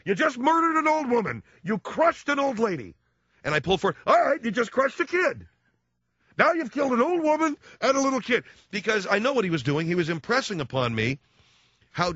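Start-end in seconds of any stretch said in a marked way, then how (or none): 0.1-2.9 high, strident mock father scolding; imitating dad. Dad scolding his son after a parking mistake, high and shrill. 4.1-8.4 high, strident mock father scolding; imitating dad. Dad scolding his son after a parking mistake, high and shrill.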